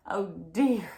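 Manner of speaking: ordinary delivery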